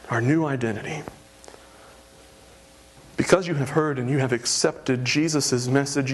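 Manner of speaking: ordinary delivery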